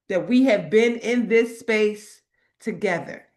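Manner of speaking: usual speaking voice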